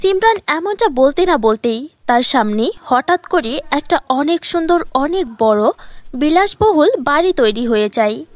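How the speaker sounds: ordinary delivery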